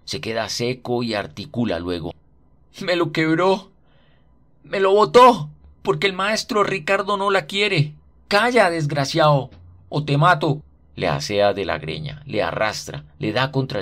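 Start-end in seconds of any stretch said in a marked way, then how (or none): 2.8-7.9 put-on high, loud, desperate child's wail; boy wails at his godmother after she smashes his rifle; anguished child. A narrator's reading. 8.3-10.6 furious threat. A narrator's reading. Godmother threatens the boy.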